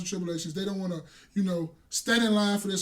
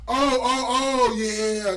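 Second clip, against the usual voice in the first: loud, raised, drawn-out oh; dawning realization; 4 semitones higher, 8 dB louder, much slower than usual. Real, not acted. Co-host suddenly gets the reference, loud realization. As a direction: let it swell out loudly as it clicks.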